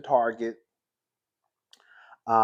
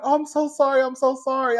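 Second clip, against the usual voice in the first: high, louder imitation of a gushing apology; apologetic neighbour; 11 semitones higher, 4 dB louder than usual. Dog owner to scared neighbour, imitated high apology. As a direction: mimic her apologizing in a raised pitch.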